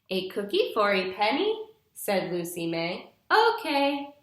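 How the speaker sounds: bright, clear storybook reading for a class; patient storytime narration for young listeners; reading to children. Acted. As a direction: read it like a picture book to little kids. Teacher reads picture book aloud.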